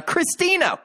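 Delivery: high-pitched soap-opera bombshell; hammed-up shock reveal, voice leaping high; mock dramatic reveal. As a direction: announce the name as a scandalous twist.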